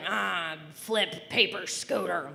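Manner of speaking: lightly voiced dad groping for words; a hint of a muddled dad; aphasic dad. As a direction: imitate dad offering random nouns, word by word. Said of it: "And he'll be like".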